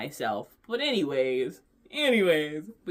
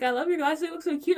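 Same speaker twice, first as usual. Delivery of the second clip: much higher and louder gushing; gushing compliment; 12 semitones higher, 5 dB louder, faster than usual. Retold or imitated. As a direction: gush over the glasses like the clerk. Clerk complimenting her glasses.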